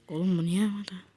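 whispered puzzled aside; hushed puzzled question. Real, not acted. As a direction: breathe it out, no voice. Unvoiced aside while playing.

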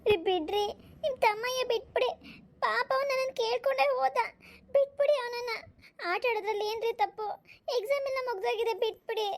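high, pleading wife's voice; pleading mother. Acted. Mother pleads with husband to release son, high and pleading. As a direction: beg him to let the boy go.

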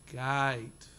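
"gate" drawn out long and flat, like "gite"; Australian gate. Shown as a demonstration. Lecturer teaching the audience the Australian "gate". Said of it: "make it very long and flat".

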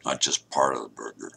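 chewing between words; talking while chewing. Real, not acted. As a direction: mouth partly full of food. Seen 0: cheeks bulge, closed-mouth chewing between phrases; lifts food at +10.

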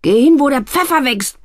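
high, loud bellow. A narrator's reading. Photographer bellowing at his sister. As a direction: roar it at her. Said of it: "brüllte der Fotograf".

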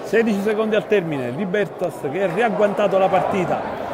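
measured basketball commentary, building tension; live play-by-play. Real, not acted. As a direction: call the final seconds steadily.

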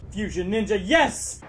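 normal talking voice

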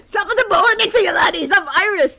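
high, loud laughing Irish parody; mocking Irish accent. Host mocks his friend's Irish voice, laughing and loud. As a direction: squeal out a cartoon Irish brogue through laughs. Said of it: "That's what he sounds like".

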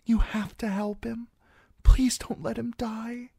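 put-on high, desperate young girl; pleading servant girl. A narrator's reading. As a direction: beg for his life. Servant girl begs for Garin.

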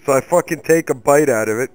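ordinary talk, nothing marked

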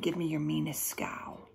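plain reading voice

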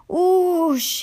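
high oosh trailing into a hiss. A narrator's reading. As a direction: imitate steam hissing off a cold engine. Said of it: "he hissed".

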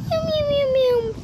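high playful meowing like a kitten; cat meows. Pretend. Player meows at a cat, high and playful. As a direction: meow up high like a little cat.

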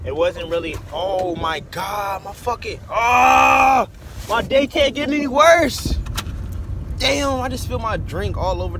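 high, loud exasperated cry; frustrated outburst. Real, not acted. Spills drink, frustrated outburst.

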